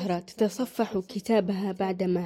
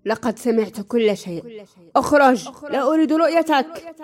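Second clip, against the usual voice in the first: shouting, much louder and higher; furious shout; 5 semitones higher, 9 dB louder than usual. A narrator's reading. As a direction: yell at him to get out. Son sends the old man away. (صراخ غاضب بصوت عالٍ)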